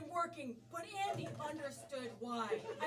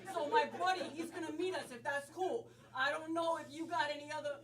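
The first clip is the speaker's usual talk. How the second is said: brash put-on New York accent; stage New York buddy. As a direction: chat like a New York wise guy. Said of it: "New York".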